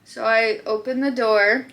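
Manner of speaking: ordinary delivery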